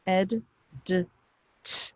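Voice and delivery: "ed", "d", "t" sounded separately; phonics sounds. Shown as a demonstration. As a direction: sound out "ed", then short "d", then voiceless "t", pausing between. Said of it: "The past tense ending".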